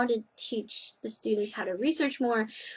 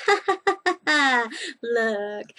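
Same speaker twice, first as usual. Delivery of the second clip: mocking universe; 2 semitones higher, 4 dB louder, slower than usual. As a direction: taunt like the universe laughing at her. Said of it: "and said".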